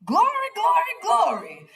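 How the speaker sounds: put-on high, loud child's cheer; a boy cheering glory with waving hands. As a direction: cheer it like an excited little boy. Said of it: "Buddy cheered".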